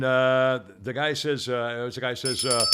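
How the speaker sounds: ordinary talk, nothing marked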